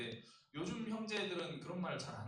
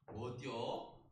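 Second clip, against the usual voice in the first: Chungcheong dialect; 4 semitones lower, much slower than usual. Retold or imitated. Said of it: "충청도에서는 뭐라고 할까요?".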